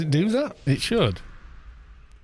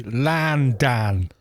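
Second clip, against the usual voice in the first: put-on "Landan" drawn out slowly with flat a sounds in a Cockney accent; slow lightly Cockney "Landan", both vowels as a; mock Cockney Landan. Welshman mocking how Londoners say London, slow mild Cockney accent. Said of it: "instead of saying London, they say".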